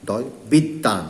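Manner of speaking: slow, বিদ্যান said biddan with doubled d; pronunciation demo. Shown as a demonstration. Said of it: "দুইবার হবে, দেখেন এ দুইবার". (ধীরে বিদ্যান উচ্চারণ, দ দুইবার)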